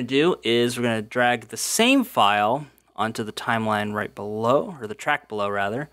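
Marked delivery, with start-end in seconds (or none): none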